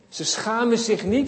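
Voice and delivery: ordinary talk, nothing marked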